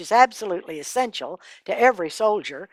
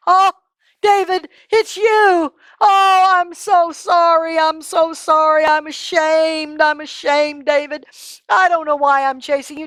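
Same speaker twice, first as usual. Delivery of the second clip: remorseful Saul; 7 semitones higher, 4 dB louder than usual. Acted. Saul apologizing to David, high and tearful. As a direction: beg forgiveness, voice breaking. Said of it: "and he says".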